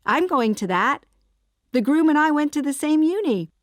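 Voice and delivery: loud, high-pitched delighted outburst; squeal-like chirp, thrilled to be going too; chirpy excitement. A narrator's reading. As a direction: gush at the coincidence. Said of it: "Amy chirped".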